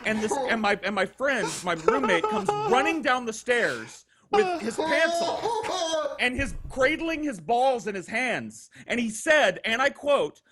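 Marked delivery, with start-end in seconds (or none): 2.2-8.7 higher, laughing through the words; can't hold the laugh telling it; laughing while telling. Real, not acted. Telling the roommate story while laughing.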